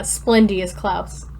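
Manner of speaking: usual speaking voice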